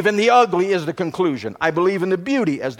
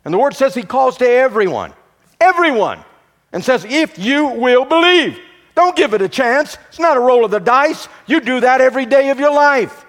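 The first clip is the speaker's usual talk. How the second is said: higher, louder preaching build, hammering each line; full-force preaching; 7 semitones higher, 4 dB louder than usual. Acted. Pastor driving the point home to congregation, full-force preaching. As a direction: preach it out, rising to the peak.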